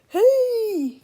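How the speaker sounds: very high, loud scream. Acted. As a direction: scream in outrage. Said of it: "screamed Big Tippy".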